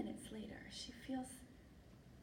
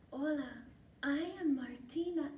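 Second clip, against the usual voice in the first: smitten Martina; 5 semitones higher, 4 dB louder than usual. Acted. Martina answers the mouse.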